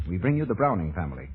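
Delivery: ordinary talk, nothing marked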